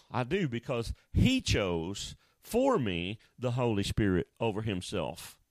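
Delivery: ordinary talk, nothing marked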